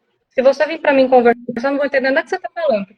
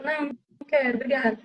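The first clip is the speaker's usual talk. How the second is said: "Não, não quero, obrigada" said much softer; 10 dB quieter than usual. Shown as a demonstration. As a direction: decline gently and softly so it won't sound rude. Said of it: "com uma voz um pouco mais baixa".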